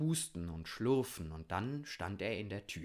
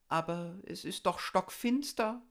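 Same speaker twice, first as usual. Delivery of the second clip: high, loud whine of a little bird; wailing lark; 10 semitones higher, 5 dB louder than usual. A narrator's reading. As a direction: wail it up high like a worried lark. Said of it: "jammerte die Haubenlerche". (hoch jammernde Haubenlerche)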